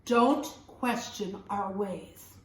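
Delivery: low, slow stern father's warning; scolding father. A narrator's reading. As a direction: drop low and slow, lay down the law. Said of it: "His father scolded him".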